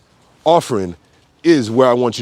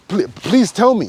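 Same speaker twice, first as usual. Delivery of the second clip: sarcastic plea; 6 semitones higher than usual. Real, not acted. Challenging the listener.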